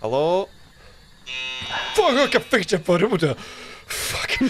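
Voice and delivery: high, hammed-up Scottish accent; bad Scottish accent. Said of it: "five minutes of bad Scottish accent".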